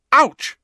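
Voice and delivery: high, loud yelp of pain; sharp ouch. A narrator's reading. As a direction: cry out in sudden pain. Sudden scar pain.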